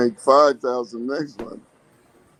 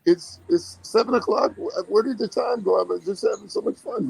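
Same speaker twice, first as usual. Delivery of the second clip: much higher, breathless surprise at the late hour; giddy, amazed the day already passed; excited disbelief; 10 semitones higher than usual. Retold or imitated. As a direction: gush like time flew while having fun. Imitating a happy, time-losing worker.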